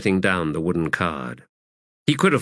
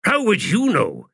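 much higher, indignant snap; sharp retort; 12 semitones higher than usual. A narrator's reading. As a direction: turn on him and snap back. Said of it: "The wizard turned on him".

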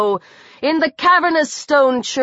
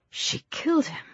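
hushed, barely voiced disbelief; stunned hush; 5 semitones higher, 10 dB quieter than usual. A narrator's reading. Stunned onlooker reacting to a killing, hushed. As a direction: say it very softly, higher, aghast.

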